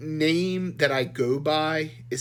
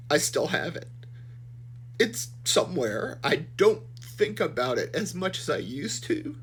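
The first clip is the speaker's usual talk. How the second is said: blubbering; 4 semitones higher, 3 dB quieter than usual. Real, not acted. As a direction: voice breaking, fighting back tears. Weird cut there.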